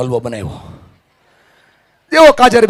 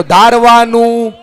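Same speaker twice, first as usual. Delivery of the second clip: higher-pitched, drawn-out rallying call; loud call-out; 5 semitones higher, 7 dB louder, much slower than usual. Shown as a demonstration. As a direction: lead the crowd in a slow, loud chant. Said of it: "જોરથી બોલો". (જોરથી, લંબાવીને બોલાવેલો નારો)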